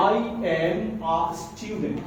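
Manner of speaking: "I am a student" said very slowly, each word separate; slow pronunciation demo. Shown as a demonstration. Teacher slowly modelling "I am a student" for the class. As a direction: draw out "am a student" slowly for learners. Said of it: "এটা singly sound হবে".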